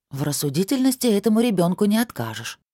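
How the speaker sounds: usual speaking voice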